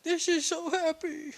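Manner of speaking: put-on high-pitched teary child voice; Naruto. Tearful child to his new protector, high little voice. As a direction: squeak it like a little kid overcome with happiness. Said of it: "Naruto tears up a bit".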